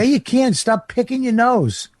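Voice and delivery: high-pitched, indignant telling-off; exasperated scolding. Real, not acted. Host telling a guest to stop picking his nose, exasperated scolding.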